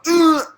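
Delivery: high, loud noise of frustration; exasperated groan. Retold or imitated. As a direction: let out a loud, high-pitched "ugh". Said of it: "you just gone".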